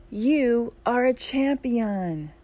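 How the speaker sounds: high, loud call, very drawn out; scream. A narrator's reading. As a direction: proclaim it slowly to the mountain at a shout. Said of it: "The man screams".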